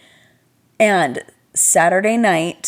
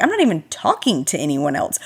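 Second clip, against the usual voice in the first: normal talking voice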